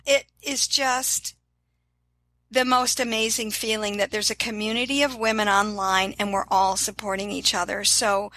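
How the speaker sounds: ordinary talk, nothing marked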